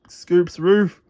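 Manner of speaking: ordinary delivery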